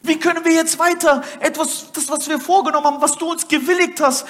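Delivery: high, loud, driving sermon voice; fervent preaching. Acted. Preacher rousing his congregation, fervent loud preaching. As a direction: preach it out to the whole hall, full force.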